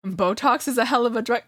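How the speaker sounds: ordinary talk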